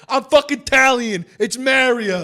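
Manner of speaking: high, shouted mock outrage; very loud. Real, not acted. Italian podcaster rejecting the other pronunciation of Mario, very loud. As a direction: holler it in big offended protest. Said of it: "I'm very loud".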